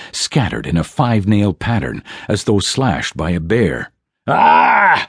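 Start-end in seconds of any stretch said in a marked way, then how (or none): 4.3-5.1 loud high battle bellow; bellowing cry. A narrator's reading. Dwarf attacking the spectral shadow with a loud bellowing cry. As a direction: roar as you swing the hammer. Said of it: "Bourbon bellowed".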